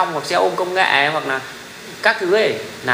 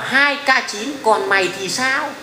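high-pitched taunt, drawn out at the end; mock a cocky kid's challenge, stretching the last words; imitated kid's challenge, pitched up; mimicking kid commenter; 4 semitones higher, much slower than usual. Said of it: "tôi nhìn avatar trẻ con".

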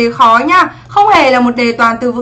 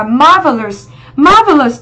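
"marvelous" with "MAR" stressed and the rest lighter; first-syllable stress; slower than usual. Shown as a demonstration. Teacher showing students option B's stress, "marvelous" stressed on syllable one. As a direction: lean on the opening "MAR" of "marvelous". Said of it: "nó sẽ trọng âm một".

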